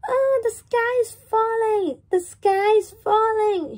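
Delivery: high, loud frightened chick voice; panicked cry. Acted. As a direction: shriek the news in terror. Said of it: "he cried".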